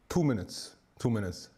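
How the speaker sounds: normal talking voice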